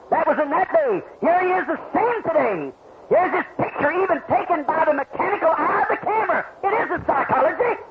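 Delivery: high, shouting revival preacher; preaching at full force. Acted. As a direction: drive the climax home to the crowd.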